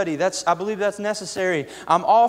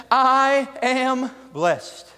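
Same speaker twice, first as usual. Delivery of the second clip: higher, very slow, stretched proclamation; climactic preaching cry; 6 semitones higher, much slower than usual. Real, not acted. Preacher declaring to the church, slow and soaring. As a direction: ring it out to the church.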